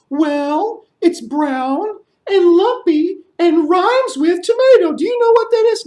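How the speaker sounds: very high bat voice; squeaky bat. Acted. Bat flying down calling to the storyteller in a high squeak. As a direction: chirp it like a small bat.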